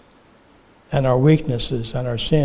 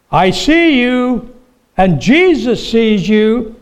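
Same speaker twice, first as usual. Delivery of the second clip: high, slow squawking parrot voice; 7 semitones higher, 4 dB louder, slower than usual. Acted. Parrot calling out to the burglar in the dark, high slow squawk. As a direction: drawl it out like a talking parrot, high and eerie. Hidden parrot talks to the thief.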